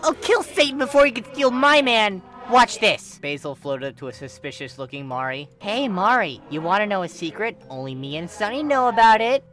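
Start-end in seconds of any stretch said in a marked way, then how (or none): 0.0-3.2 very high, loud furious yell; furious shout. Acted. Furious outburst about boyfriend. 5.6-9.4 higher, louder sing-song tease; teasing bait. Acted. Teasing Mari with a secret.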